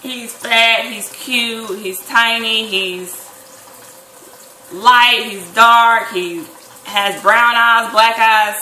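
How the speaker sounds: loud, punched list of guesses; hammed-up, insistent listing; emphatic imagined reply. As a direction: hit each description hard, acting out the expected answer. Lists replies she expected from him.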